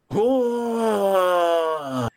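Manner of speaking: long, high "oooh" of delight; overjoyed drawn-out exclamation; joyful "oh". Acted. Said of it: "in absolute joy".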